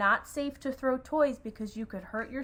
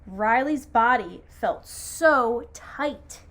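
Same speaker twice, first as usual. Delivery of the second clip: slow, emphatic storytelling; dramatic picture-book narration with stretched words; story read-aloud; 5 dB louder, slower than usual. A narrator's reading. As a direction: draw out each phrase for the children. Reads story to children.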